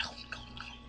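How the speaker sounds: breathless whisper between bites. Retold or imitated. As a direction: whisper it while wolfing food. Luffy devouring the princess's bread.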